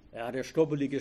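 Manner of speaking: usual speaking voice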